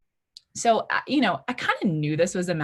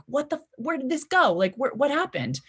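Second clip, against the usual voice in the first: mock yelling; 8 semitones higher than usual. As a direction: imitate yourself panicking at the bouncer, a mild mock yell. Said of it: "I start yelling at him".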